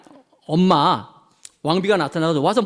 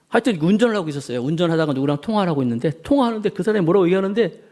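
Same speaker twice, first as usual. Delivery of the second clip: normal talking voice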